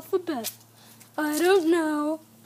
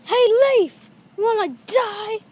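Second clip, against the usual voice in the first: loud, higher taunting challenge; play-fight taunt, raised voice; picking a fight; 6 semitones higher, 7 dB louder than usual. Acted. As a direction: call out a mock threat. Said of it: "I get in fights with leaves".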